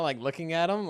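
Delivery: ordinary talk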